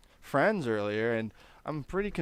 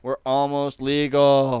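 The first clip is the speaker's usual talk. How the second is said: loud hype show-host intro; podcast announcer; 3 semitones higher, 13 dB louder, slower than usual. Acted. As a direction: boom it out like a host welcoming fans.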